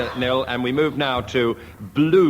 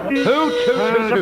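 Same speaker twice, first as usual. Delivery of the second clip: mock owl hoots; 13 semitones higher, 4 dB louder than usual. Hooting like an owl.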